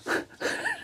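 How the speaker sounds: high airy giggle; a quick unvoiced laugh; breathy laugh. Real, not acted. Laugh at false start.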